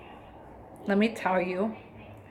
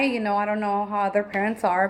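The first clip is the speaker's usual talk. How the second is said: usual speaking voice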